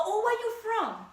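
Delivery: high, loud put-on voice of a surprised coworker; mimicking coworkers. Coworker hearing her accent, asking in a high mock voice. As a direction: squeal it like they just spotted the accent.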